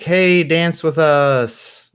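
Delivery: put-on loud, high, drawn-out party girl.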